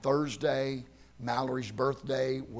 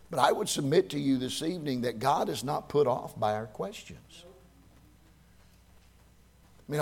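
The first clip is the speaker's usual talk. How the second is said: ordinary talk, nothing marked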